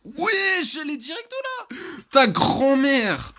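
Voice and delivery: high, loud startled yelp; startled outburst. Real, not acted. Gamer caught at once by Granny, startled high outburst. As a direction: jump out of your skin.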